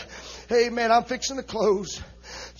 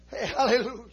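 ordinary talk, nothing marked

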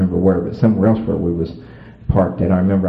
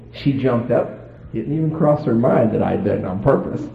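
ordinary talk

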